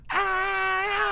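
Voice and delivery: sudden high loud yelp of excitement; excited scream. Real, not acted. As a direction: let out a thrilled shriek.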